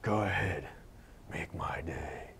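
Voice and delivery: low, quiet, whispered menace; Clint Eastwood impression. Clint Eastwood's whispered threat to a punk. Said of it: "I give you a little Clint Eastwood".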